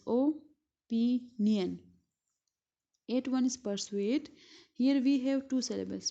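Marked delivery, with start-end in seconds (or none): 0.1-1.8 opinion spoken very slowly; teacher counting beats aloud for students; slow syllable demo. Shown as a demonstration. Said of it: "in opinion we have three syllables".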